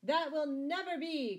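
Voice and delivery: high, loud outburst; defiant cry. A narrator's reading. Scarlet defying the sheriff's threat, crying out. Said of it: "cried Scarlet".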